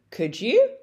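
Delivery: "Could you?" lifts up on "you"; rising intonation. Shown as a demonstration. Teacher repeating the tag for students, rising tune. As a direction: rise on "you". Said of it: "you're using that rising intonation".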